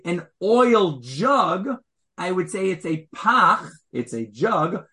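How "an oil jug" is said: "an oil jug" drawn out, louder on "oil"; slow emphasis. Shown as a demonstration. Teacher contrasting the two phrasings, slow and emphatic. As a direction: lean into "oil" slowly. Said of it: "If I wanted to describe it".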